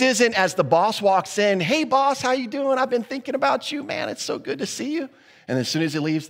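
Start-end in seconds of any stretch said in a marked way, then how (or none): 1.6-5.1 high, sugary greeting, oozing fake friendliness; fake flattery. Employee greeting the boss as he walks in, high and fake-sweet. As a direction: suck up to the boss with a syrupy lilt.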